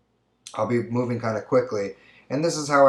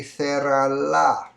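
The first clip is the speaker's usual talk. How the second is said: "cerrarla" drawn out, higher and louder; slow, emphatic answer; 5 semitones higher, 6 dB louder, much slower than usual. Shown as a demonstration. Teacher revealing the right answer to students. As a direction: announce the answer slowly. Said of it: "It would be".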